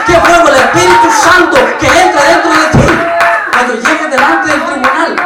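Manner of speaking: builds to loud, ringing preaching, pushing the church to wake up; fiery preaching. Acted. Preacher urging the church not to be lukewarm, fiery preaching. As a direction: preach it with rising fire, voice swelling on each appeal.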